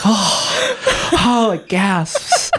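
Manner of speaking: high laughing mock gasps of a crowd; mock crowd awe, high and laughing; imitating gasping crowd. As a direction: play a whole crowd going oh in wonder. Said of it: "The crowd's like".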